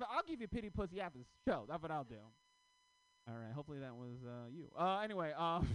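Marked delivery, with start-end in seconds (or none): none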